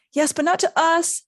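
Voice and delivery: high, loud exasperated protest. A narrator's reading. Ron argues back at Neville, exasperated. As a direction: protest at full exasperation, pitch up. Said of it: "in exasperation".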